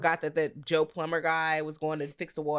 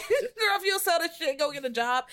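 laughing scold; 9 semitones higher than usual. Retold or imitated. Scolding the heroine, cracking up.

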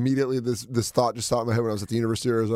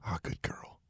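whisper; 17 dB quieter than usual. Pretend. Echoing whispered praise.

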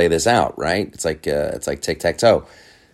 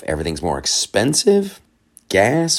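ordinary delivery

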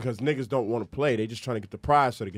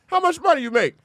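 high, loud acted demand; mom grilling her son; 7 dB louder than usual. Mom asking son about his takings, loud interrogation. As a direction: demand it as the mother, pitched way up.

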